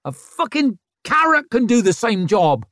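loud high scornful shout; defiant shout. Acted. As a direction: shout it at everyone. Rikke still roaring.

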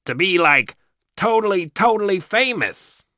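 put-on ditzy mall teen voice; mall rat voice. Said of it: "Satan puts on a mall rat voice".

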